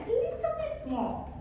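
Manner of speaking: tiny high squeak of a voice; high, stretched, little voice; small voice. Shown as a demonstration. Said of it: "sometimes the voice also".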